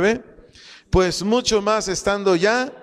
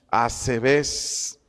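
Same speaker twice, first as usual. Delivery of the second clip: "Asebes" stressed on its last E, drawn out; stress on second E; 4 semitones lower than usual. Shown as a demonstration. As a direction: say "Asebes" lower, leaning on the final E. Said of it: "acento en la segunda". ("Asebes" con acento en la segunda E)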